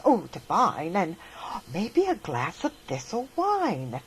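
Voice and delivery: plain reading voice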